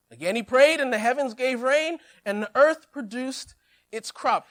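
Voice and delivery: ordinary talk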